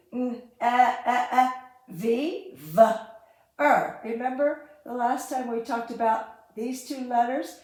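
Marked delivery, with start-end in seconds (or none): 0.1-4.0 short "e" and "v" sounded out separately, loud; clipped sound-by-sound spelling; staccato. Shown as a demonstration. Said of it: "short E".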